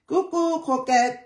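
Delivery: light up as you greet a friend; sing-song hello to a viewer; excited greeting. Real, not acted.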